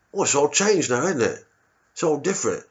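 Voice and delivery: a cagey East Londoner dodging the question; a touch of a cagey Londoner; vague local. Retold or imitated. Vague local voiced in story.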